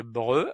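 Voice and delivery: breu with a rounded eu sound; syllable breu. Shown as a demonstration. Teacher showing learners how to read breu. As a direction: say breu clearly. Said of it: "avec un E, ça fait".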